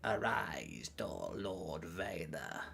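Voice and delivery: low, whispery, slow villain voice; hushed, drawn-out dark lord impression; Palpatine. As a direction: intone softly and drag out each word.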